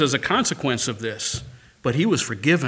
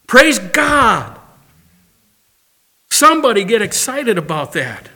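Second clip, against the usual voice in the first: high, loud revival preaching; full-voiced pulpit cry; preaching at full force; 10 semitones higher, 10 dB louder than usual. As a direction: lift the room, calling out praise. Opening of the sermon.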